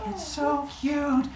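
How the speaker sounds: high, slow cooing; doting mother. Retold or imitated. Rhea gazing at the newborn, slow high coo. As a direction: melt over the baby, high and slow. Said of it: "loving mother look".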